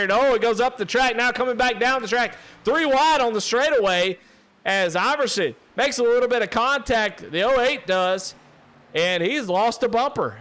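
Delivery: high, loud excited call of the contact; excited play-by-play. Real, not acted. Commentator calling contact between racers, rising excitement. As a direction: ride the action up as the cars touch.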